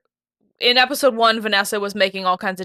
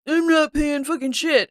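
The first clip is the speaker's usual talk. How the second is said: higher, mock angry tough guy; imitated yelling; 6 semitones higher than usual. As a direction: sneer it like a hothead. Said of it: "like, yelling at Buck".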